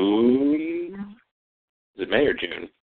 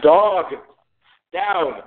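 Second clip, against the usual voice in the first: real yell at the dog; high, loud, abrupt command; 8 semitones higher, 5 dB louder than usual. Real, not acted. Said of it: "he left because I yelled".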